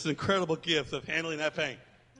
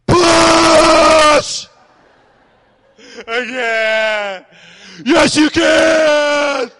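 coaching shout; 11 semitones higher, 19 dB louder, much slower than usual. Retold or imitated. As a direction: shout it high and loud like a labour coach. Husband coaching wife in labour.

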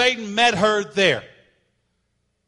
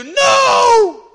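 high, loud cry of no; mock yell at the TV; 12 dB louder than usual. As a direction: shout it at the screen. Yelling at TV animal.

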